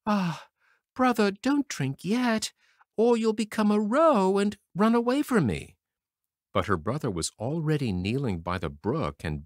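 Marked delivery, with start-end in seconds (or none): 0.0-5.8 high pleading sister; begging. A narrator's reading. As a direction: beg him, much higher. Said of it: "And she begged".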